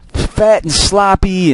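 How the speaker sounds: fat and sloppy dragged out slow and high; drawn-out emphasis. Real, not acted. Ranting about obese people, drags out fat and sloppy slowly. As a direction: stretch every word of fat and sloppy.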